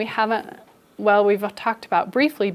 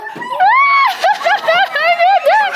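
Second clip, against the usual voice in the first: very high, loud screaming laugh; hysterical shriek-laugh; 8 dB louder than usual. Acted. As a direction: shriek like a melting-down toy. Letter U freaks out.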